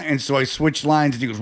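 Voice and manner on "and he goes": ordinary talk, nothing marked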